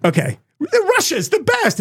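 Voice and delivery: high, mocking praise of Russia; Tucker raving. Mocking Tucker praising Russia, high gushing voice. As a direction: gush like a starstruck tourist. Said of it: "And it's like".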